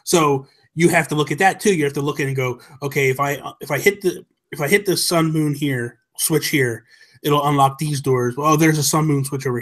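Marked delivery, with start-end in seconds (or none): none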